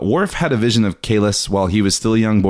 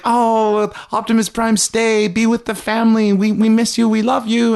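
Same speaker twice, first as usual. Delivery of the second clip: put-on high-pitched whining children; mock children pleading, pitched way up; pleading kids; 12 semitones higher than usual. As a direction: beg like a clingy little kid. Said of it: "all these kids are like".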